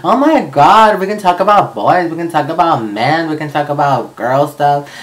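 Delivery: high, loud, gushing squeal of delight; excited friend. Retold or imitated. Best friend reacting to his coming out, gushing excitement. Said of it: "she got so excited".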